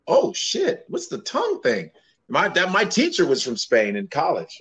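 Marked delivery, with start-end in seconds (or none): 0.1-1.9 very high astonished squeal; shocked squeal. Retold or imitated. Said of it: "I was like".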